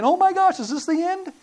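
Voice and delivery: high-pitched mock panic, loud at the start; freaking-out demon. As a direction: gasp it out like a terrified demon. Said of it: "these demons were freaking out".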